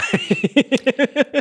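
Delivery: squealing high laugh. Real, not acted. Laughing at own retelling.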